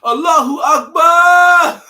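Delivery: high, slow scream with laughter; shouting praise after finding money; joyful scream. Real, not acted. Man finds hundred dollar bill, screams with laughing joy. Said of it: "I screamed that shit in the hallway".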